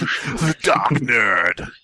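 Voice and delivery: slow, drawn-out ominous title; mock-dramatic title. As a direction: drag the name out like a horror trailer.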